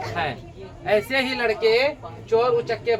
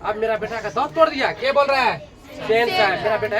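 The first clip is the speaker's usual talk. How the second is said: normal talking voice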